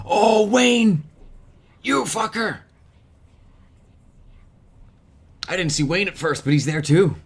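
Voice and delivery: high, loud startled cry; surprised shout. Real, not acted. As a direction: jolt up at the sudden discovery. Spots a hidden figure on screen.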